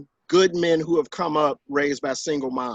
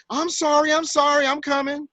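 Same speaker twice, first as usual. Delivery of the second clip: much higher imitated female voice; mock a girlfriend hurrying to apologize; apologetic girlfriend; 11 semitones higher than usual. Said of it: "but the response from her".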